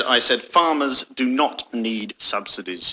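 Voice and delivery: slow, low, pointed restatement; deliberate repetition. Acted. Spokesman repeating policy to interviewer, slow and pointed. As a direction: repeat it slowly as if to someone deaf. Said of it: "Do you need your ears syringing".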